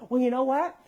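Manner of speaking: put-on high, coaxing lead-in for a toddler; talking to small child. Adult coaxes three-year-old, child-directed voice.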